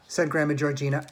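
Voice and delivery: plain reading voice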